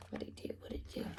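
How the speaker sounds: breathless whispered question. Real, not acted. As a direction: whisper it twice, eager to know. Suspense whisper to the kids.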